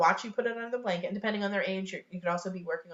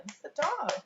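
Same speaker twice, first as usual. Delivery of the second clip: high sing-song naming; excited pointing-out; 8 semitones higher than usual. Acted. Parent and child both spot the dog, excited. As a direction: point it out brightly to a toddler.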